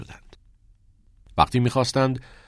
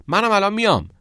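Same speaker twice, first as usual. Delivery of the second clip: high, loud call after friends; raised yell from behind; shouting; 13 semitones higher, 6 dB louder, faster than usual. A narrator's reading. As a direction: shout it to people walking away. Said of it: "از پشت سرشان داد زد".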